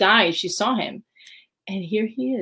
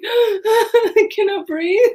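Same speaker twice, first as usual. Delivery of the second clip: high, slow, laughing near-sob "I cannot breathe"; laughing overwhelm; 13 semitones higher, 7 dB louder, much slower than usual. Retold or imitated. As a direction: gasp it out laughing and half crying. Overwhelmed after the compliment.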